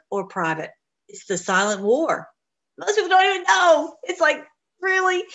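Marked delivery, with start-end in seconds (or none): 2.8-5.2 incredulous. Real, not acted. Exclaiming in disbelief.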